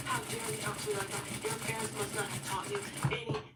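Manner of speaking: fast, mock-furious scolding; fast imitation of her tirade at the daughter-in-law; imitating angry rant. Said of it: "she's just going off on Missus Kim".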